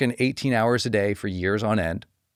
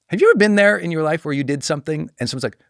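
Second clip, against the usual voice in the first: ordinary talk, nothing marked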